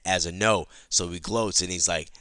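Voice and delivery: plain reading voice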